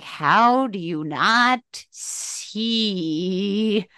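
very slow, stretched-out plea; slow, incredulous emphasis on each word; exasperated, drawn out. Real, not acted. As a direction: drag out every word in disbelief. Repeats her exasperated question, stretched out.

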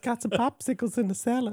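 gopher impression. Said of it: "gopher impression".

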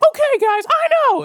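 extremely high-pitched whiny squeal; mock a friend in piercing falsetto; John's old voice. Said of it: "that's how he talked".

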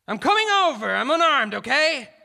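high, loud shout across a distance; calling out. A narrator's reading. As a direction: holler it over to the gunman. Negotiator calls out to gunman.

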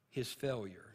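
usual speaking voice

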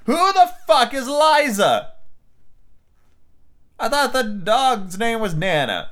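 high, slow, baffled outburst; who on earth is that; incredulous. Real, not acted. Reader's own outburst mid-story.

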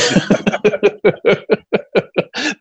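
a long high-pitched laugh. Real, not acted. Laughs about the guest spot.

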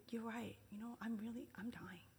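tender whispered admission; gentle whisper. Retold or imitated. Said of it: "Jesus is saying".

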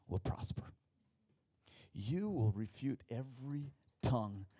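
slow, solemn verse reading; measured preacher's declaration; scripture recitation. Acted. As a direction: proclaim each phrase with weight.

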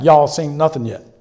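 loud mock Texas twang; a Texan voice, retold with relish. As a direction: imitate a punchy Texas twang. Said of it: "you know, Texas twang".

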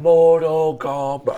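drawn-out announcer call. Pretend. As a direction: boom it like the game intro. Echoing game title dramatically.

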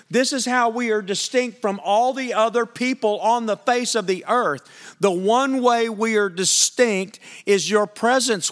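building sermon cry; full-force preaching. Real, not acted. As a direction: preach it to the back row.

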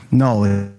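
ordinary talk, nothing marked

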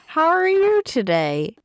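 a mild try at a film star's voice; Julia Roberts impression. Daughter asking mom, lightly voiced as Julia Roberts. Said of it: "that does not sound like you".

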